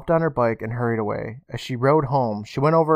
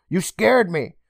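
higher, breathless relief; relieved startle; 7 semitones higher, 5 dB louder than usual. Acted. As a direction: shaken but relieved. Emma relieved after fright.

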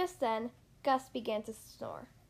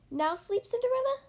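high, louder squeaky mouse plea; 11 semitones higher, 5 dB louder than usual. Acted. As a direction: rise up high like an eager mouse. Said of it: "another mouse cried".

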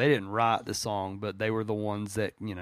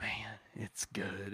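whispering; 11 dB quieter, much slower than usual. Real, not acted. Awed after the sung quote.

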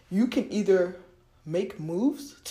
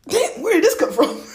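loud, high burst of surprise; mock astonishment; 10 semitones higher, 7 dB louder than usual. Finding two hundred dollars unexpectedly, playfully astonished. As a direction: exclaim it in playful disbelief. Acted surprise at money turning up.

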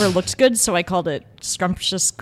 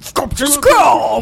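booming monster truck announcer; 6 semitones higher, 7 dB louder, much slower than usual. Pretend. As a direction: shout it like a truck rally ad. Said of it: "monster truck rally voice".

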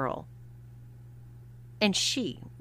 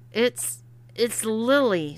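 glum; 4 semitones higher, 7 dB louder, slower than usual. Acted. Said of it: "said William glumly".

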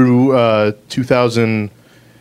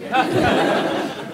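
laugh; 11 semitones higher, 7 dB quieter than usual. Real, not acted. Laughing at own reply.

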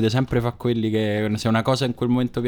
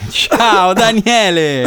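hammy greeting; 9 semitones higher, 7 dB louder, much slower than usual. Acted. Host greets guest on podcast.

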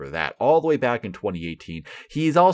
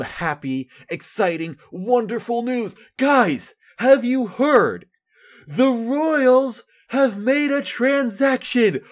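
high, loud, slow mock-excited announcement; sarcastic hype; 12 semitones higher, 4 dB louder, slower than usual. Royals fan fakes thrill over a minor trade, mock excitement. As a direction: oversell the news with heavy sarcasm.

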